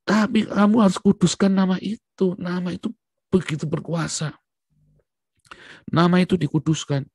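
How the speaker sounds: loud, high preaching voice; fervent sermon cry. Acted. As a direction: proclaim it to the congregation. Preacher stresses holy name.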